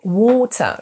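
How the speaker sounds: "water" as WAW-tuh in British English; slow British "water", no final R; British accent. Shown as a demonstration. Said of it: "si vous préférez l'accent britannique".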